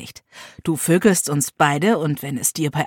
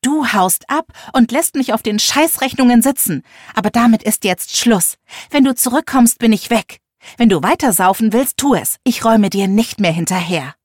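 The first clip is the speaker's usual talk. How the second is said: loud, higher furious tirade; yelling in anger; 4 semitones higher, 6 dB louder than usual. Acted. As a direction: scream every grievance at her. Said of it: "Ich schrie meiner Mutter alles entgegen".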